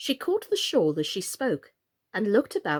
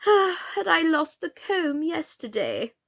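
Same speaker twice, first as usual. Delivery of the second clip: sighing; 9 semitones higher, 4 dB louder, slower than usual. A narrator's reading. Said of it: "the Queen said with a sigh".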